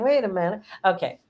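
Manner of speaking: ordinary talk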